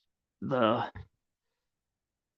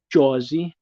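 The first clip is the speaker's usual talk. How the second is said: Jersey said in a failed Jersey accent, the second much higher; Jersey accent attempt; 4 semitones higher, 3 dB louder than usual. Shown as a demonstration. Streamer fumbling a Jersey accent on Jersey. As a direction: try Jersey in a Jersey accent and miss. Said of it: "I can't do a Jersey accent".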